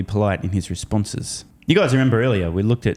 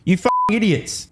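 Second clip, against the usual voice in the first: exasperated; 9 semitones higher than usual. Real, not acted. Exasperated insult at CNBC.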